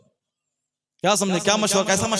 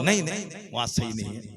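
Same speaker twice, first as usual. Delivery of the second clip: quick repeated refusals in a lowered voice; negative relatives; 4 semitones lower, 5 dB quieter, faster than usual. Retold or imitated. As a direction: mildly imitate dismissive relatives, lower. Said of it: "माने सब negative".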